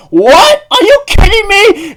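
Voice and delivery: very high, loud disbelief; shocked shriek. Retold or imitated. Everyone reacts in disbelief.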